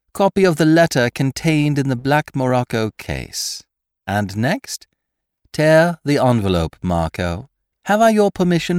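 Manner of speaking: mock-courteous gang boss; Lupin on the telephone. A narrator's reading. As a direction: savour the moment while giving orders.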